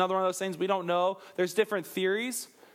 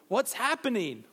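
ordinary talk, nothing marked

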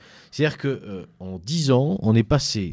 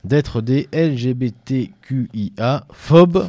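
LGBTQIA phobes spelled out slowly, letter by letter, louder; dictating; 3 semitones higher, 6 dB louder, slower than usual. Retold or imitated. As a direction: spell out each letter with weight. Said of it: "ouvrez les guillemets et accrochez vos oreilles".

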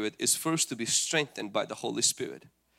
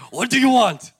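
loud, high, exuberant question; playful demand; 8 semitones higher, 10 dB louder than usual. Acted. King presses the queen at the banquet, loud and playful. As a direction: burst out with it after chuckling.

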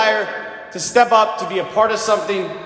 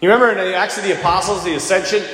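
ordinary delivery

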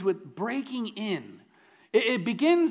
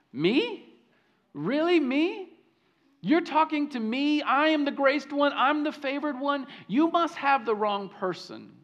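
incredulous surprise; 6 semitones higher than usual. Acted. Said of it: "She's thinking".

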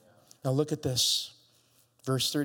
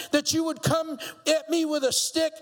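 mock roar; 6 semitones higher, 3 dB louder than usual. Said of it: "he roared at David".

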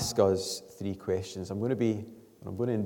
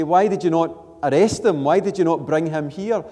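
raised, loud voice imitating indignant questioners; higher and louder, press both questions as a challenge; demanding questioning; 7 semitones higher, 9 dB louder than usual. Pharisees challenging the temple guards, loud and demanding. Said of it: "question the temple guards. They say".

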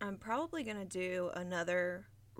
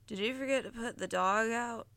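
sleepy; 4 semitones higher, 3 dB louder than usual. Acted. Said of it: "asked Harold sleepily".

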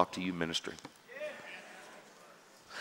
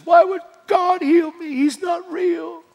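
very high mock wail, drawn out at the end; imitated bawling; 4 dB louder, slower than usual. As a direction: wail it like the woman breaking down. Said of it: "Started bawling, crying".

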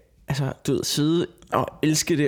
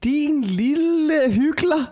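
high, slow, put-on scolding tone; mock accusing voice; 13 semitones higher, much slower than usual. Teasing her co-host, slow high put-on scolding. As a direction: drag it out like a mock villain.

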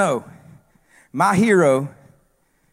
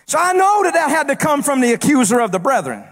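raised, rising preacher's delivery; full-force preaching; 10 semitones higher than usual. Acted. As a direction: bring it back to the pulpit voice. Preaching again.

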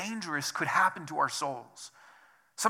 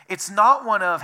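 ordinary talk

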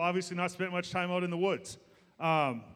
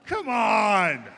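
loud, drawn-out protest; exasperated shout; 2 semitones higher, 6 dB louder than usual. Real, not acted. Preacher to a congregation after his pun falls flat, exasperated shout. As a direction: shout in mock exasperation at the crowd.

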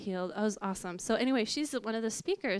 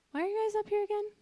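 high, fast, puzzled question; oblivious preacher; 10 semitones higher, much faster than usual. Retold or imitated. Preacher asking the couple why they are still up front, high and fast. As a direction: dash it off, clueless that you forgot them. Preacher forgot about them.

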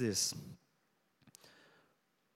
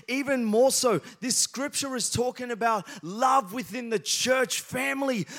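raised, higher-pitched sermon delivery; impassioned preaching; 6 semitones higher than usual. Real, not acted. Preacher urging church family to love, impassioned. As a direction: drive each point home to the congregation.

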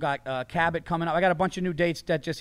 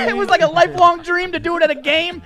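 laughing telling; 12 semitones higher, 9 dB louder than usual. Real, not acted.

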